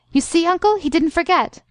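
put-on high, eager girl's voice; excited vindication. A narrator's reading. Niece proves her point to her uncle, excitedly. Girl delighted the old man came.

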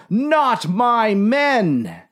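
slow, high cry of protest; anguished yell. A narrator's reading. Captain crying for his fallen men, drawn-out yell. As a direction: draw each word out high. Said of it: "yelled the captain".

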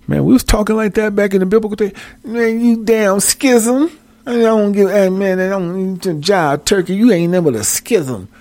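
high, jive street voice; old-time jive talk. Pretend. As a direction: ham up a seventies jive hustler. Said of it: "Can you imagine that?".

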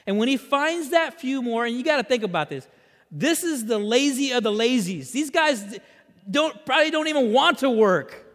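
high, loud storytelling from the pulpit; animated preaching. Real, not acted. Preacher retelling the farmer's hiring, animated preaching. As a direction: press the parable forward with energy. Preaches the parable at full force.

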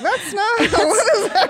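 high, laughing disbelief; high giggly mock disbelief. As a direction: laugh through the question. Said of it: "I'm like".

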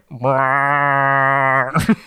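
goofy rising creature honk; dumb dinosaur call. Retold or imitated. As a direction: make a silly dinosaur noise. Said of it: "it just kind of goes like a".

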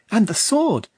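put-on high, eager boy's question; child voice. A narrator's reading. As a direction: ask like an eager boy.

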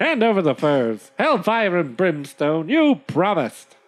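hammed-up indignant demand; mock-furious trader, pitch climbing at the end. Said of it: "The trader says".

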